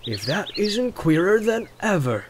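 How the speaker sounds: high, drawn-out farmer's astonishment; slow wondering rustic exclamation; amazed exclamation. Acted. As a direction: stretch it out in amazed disbelief. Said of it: "he exclaimed".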